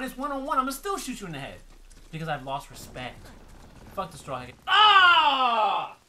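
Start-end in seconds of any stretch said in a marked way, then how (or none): 4.7-6.0 loud, drawn-out high yell; sustained frustrated shout; long yell. Real, not acted. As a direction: let out one long holler. Cries out in the game.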